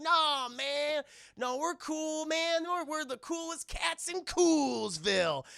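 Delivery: put-on high, slow, goofy kid voice; woozy kid insisting he's cool; dazed cool kid. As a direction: brag while still dizzy. Dizzy Hogarth bragging.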